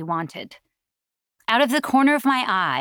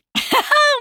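loud, high burst of laughter; hearty laugh; 6 dB louder than usual. A narrator's reading. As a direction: let out a big, high laugh. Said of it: "She laughed heartily".

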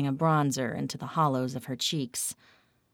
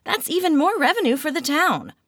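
excited; 12 semitones higher, 7 dB louder, faster than usual. A narrator's reading. Kat's excited pitch to Lauren.

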